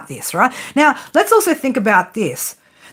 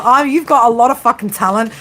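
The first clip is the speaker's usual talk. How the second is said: ordinary talk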